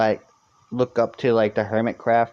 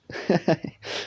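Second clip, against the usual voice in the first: a soft unvoiced chuckle; breathy laugh; 7 semitones higher, 4 dB quieter than usual. Real, not acted. Streamer laughs off chat's reminder, soft unvoiced chuckle.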